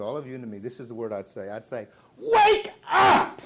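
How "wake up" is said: huge, high-pitched yell; rousing shout. Acted. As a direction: shout to jolt a whole nation awake. His one word to the nation.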